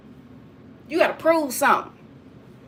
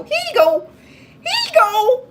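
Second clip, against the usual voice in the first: mock high offering; 10 semitones higher than usual. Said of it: "be like".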